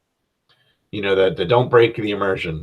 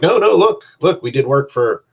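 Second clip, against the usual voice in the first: higher, louder insistent plea; protesting; 7 semitones higher, 5 dB louder than usual. Acted. Party pleading with skeptical leaders, protesting. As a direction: jump in to argue your credentials.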